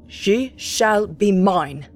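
slow, menacing shout; shouted vow. Acted. Captain shouting back while dashing down the cliff. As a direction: hurl the threat back as he leaves. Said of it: "shouting as he did so".

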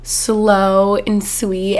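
very drawn-out, slow and sweet; drawn-out slow words. Retold or imitated. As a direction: stretch it out like a slow Southern talker. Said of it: "she talks really, like, slow and sweet".

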